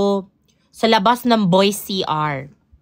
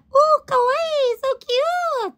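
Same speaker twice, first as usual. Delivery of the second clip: very high, slow squeal of kawaii; cute Japanese fan; much slower than usual. Retold or imitated. Mimicking Japanese fans praising her nails, high squeal. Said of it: "Sabi nila".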